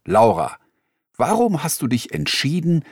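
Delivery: normal talking voice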